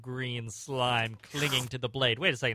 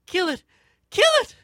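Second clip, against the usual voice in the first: high mock-panicked squeal, growing louder; heroine's frantic plea; 10 dB louder, faster than usual. Heroine clings to her protector, frantic squeal. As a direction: squeal it in pretend panic, second one higher.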